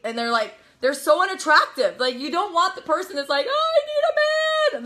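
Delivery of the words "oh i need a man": very high, hammed-up wail; needy, desperate. Needy single in a bar begging, mock desperate. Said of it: "You're needy, you're desperate".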